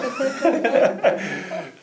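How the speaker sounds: a short laugh that tumbles down; real amused laugh; high bright laugh. Real, not acted. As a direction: laugh at your own story. Host laughs after quoting guests.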